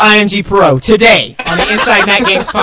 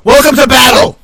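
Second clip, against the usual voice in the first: loud, higher announcer shout; battle cry; 4 semitones higher, 5 dB louder than usual. Pretend. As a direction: shout it like an arena host. Game host launches caller battle.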